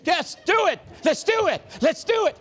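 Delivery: high, rhythmic cheering; hammed-up excited chant; comic frenzy. Relives dancing inside the cheering circle.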